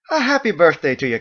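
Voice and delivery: high, bright-toned Irish-style hostess; Margot's lilting voice. A narrator's reading. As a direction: play the chatty innkeeper woman, high.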